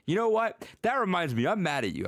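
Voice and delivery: high-pitched imitation of an upset student; retold indignation, pitch falling as it goes; mock upset. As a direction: act out a huffy complaint, starting high. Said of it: "she was really upset".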